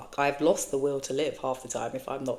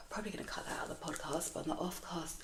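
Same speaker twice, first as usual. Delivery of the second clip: breathy whisper, much softer, half to herself; whispered aside; 2 semitones higher, 13 dB quieter, faster than usual. Real, not acted. Host whispering a side remark about cutting this from the podcast, hushed aside. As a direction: whisper it like a note to your editor.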